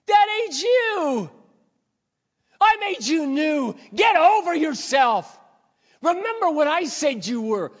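high, loud, slow proclamation; emphatic divine correction at full volume; voicing God. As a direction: declare it with booming pulpit conviction. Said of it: "all God says is".

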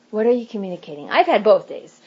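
ordinary delivery

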